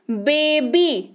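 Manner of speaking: "बेबी" very slowly, split into बे and बी; two syllables. Shown as a demonstration. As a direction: stretch "बेबी" into two separate beats. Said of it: "double syllable है".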